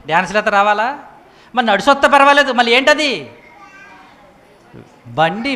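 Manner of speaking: normal talking voice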